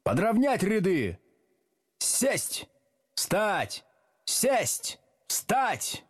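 high-pitched sergeant-like orders; thundering drill commands. A narrator's reading. Teacher drills unruly class, thundering. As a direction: fire off separate drill commands. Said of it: "гремел Слушкин".

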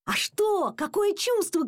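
high, excited calling out; shouting dad. A narrator's reading. Dad quizzing his son about catching a flea, shouting. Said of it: "кричал папа".

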